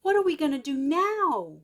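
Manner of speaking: Abba's voice, fretting at the closed road; fretful Abba voice. Acted.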